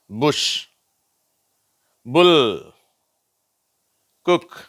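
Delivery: "புஷ்", "புல்", "குக்" each alone with short u; short u drill. Shown as a demonstration. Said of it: "இது உ என்ற குறில் ஓசை".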